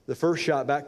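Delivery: ordinary delivery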